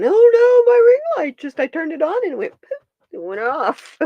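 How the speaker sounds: high, loud mock panic that speeds up. Retelling the ring light scare to Kate, mock panicked. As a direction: play your own panicked self. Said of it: "like, panicking".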